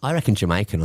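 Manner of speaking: usual speaking voice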